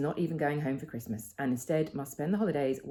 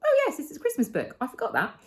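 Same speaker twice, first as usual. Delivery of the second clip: much higher, bright mock surprise at remembering; imitating a delighted oh-yes moment; sudden realization; 8 semitones higher, 5 dB louder than usual. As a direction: act out the penny dropping. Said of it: "and I thought".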